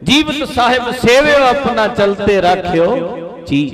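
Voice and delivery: high-pitched scripture recitation; verse recitation. Acted.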